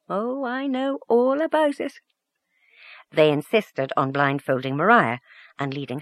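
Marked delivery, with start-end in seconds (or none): 0.1-1.9 high, playful knowing tease; teasing aunt voice. Acted. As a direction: tease her like a fond aunt. Mrs Donnelly teasing the blushing girl.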